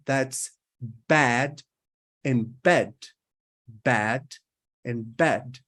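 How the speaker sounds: "bad" with a wide-open a, "bed" with a closed e; bad versus bed. Shown as a demonstration. Teacher answering a student's bad versus bed question with a clear contrast. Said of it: "the difference between".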